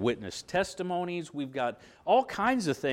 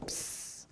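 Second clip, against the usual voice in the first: sizzle noise; 15 dB quieter than usual. Retold or imitated. Imitates the iron burning.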